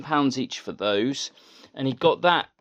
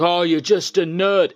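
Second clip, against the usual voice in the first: higher and louder mock sneer in a put-on voice; imitating angry sneer; 6 semitones higher, 8 dB louder than usual. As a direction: scoff at him like a sore loser. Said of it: "And he was so angry".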